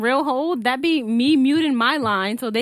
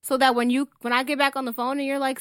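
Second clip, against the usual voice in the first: ordinary talk, nothing marked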